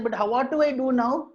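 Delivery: high-pitched worried question; pleading monkey. Acted. Monkey asking the owl what to do now, pleading.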